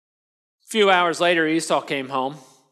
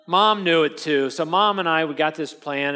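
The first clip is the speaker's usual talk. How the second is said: ordinary talk, nothing marked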